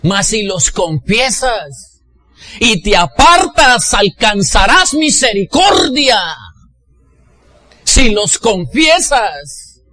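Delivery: loud, high, drawn-out preaching; impassioned, slow, high-pitched sermon delivery; preaching at full force. Real, not acted. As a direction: thunder it out to the congregation.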